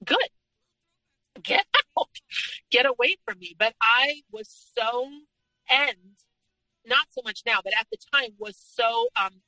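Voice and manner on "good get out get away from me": imagined relief. Retold or imitated. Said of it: "most people would probably think".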